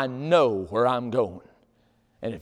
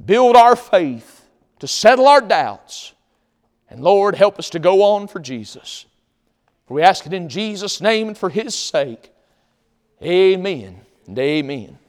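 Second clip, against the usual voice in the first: fervent prayer; 3 semitones higher, 5 dB louder than usual. Real, not acted. Closing prayer.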